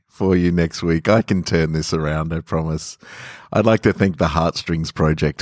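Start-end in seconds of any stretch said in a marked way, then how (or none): none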